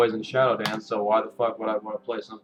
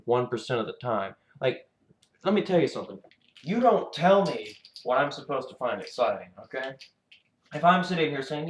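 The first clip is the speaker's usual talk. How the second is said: ordinary delivery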